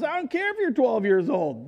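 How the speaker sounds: ordinary talk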